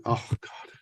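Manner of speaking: breathless, unvoiced sinking groan; deflated oh God. Coach seeing only two grand, deflated whisper. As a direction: let the pride drain out in a whisper. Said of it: "excited demeanor just went".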